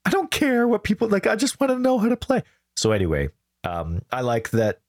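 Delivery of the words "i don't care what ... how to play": squeaky high put-on whine; high whiny voice. His own whiny retort, quoted.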